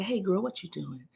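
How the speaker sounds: low, softly modulated greeting. Retold or imitated. Host plays her sister greeting a girl, soft voice. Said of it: "one of those really soft modulated voices".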